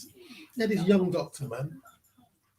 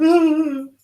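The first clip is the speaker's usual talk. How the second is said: sudden cry, much higher and louder; shocked cry; 12 semitones higher, 14 dB louder than usual. Retold or imitated. Patient reacting to being told he will die, sudden loud shocked cry. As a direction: yelp out in shock at the news. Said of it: "the patient went".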